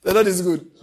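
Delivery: proclaiming. Real, not acted. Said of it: "I said the Lord is good".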